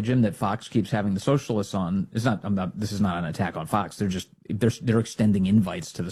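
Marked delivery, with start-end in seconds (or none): none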